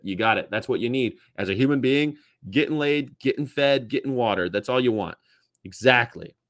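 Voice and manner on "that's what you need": ordinary talk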